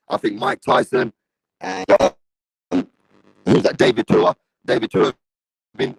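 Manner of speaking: crackly, cutting-out live-stream audio; broken mic. Real, not acted. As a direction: voice breaking up through a shorting mic. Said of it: "I sound like staticky".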